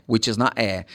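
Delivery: usual speaking voice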